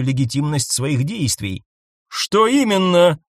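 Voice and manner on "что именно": slow, loud insistent demand. A narrator's reading. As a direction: demand the answer. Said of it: "потребовала Ангел".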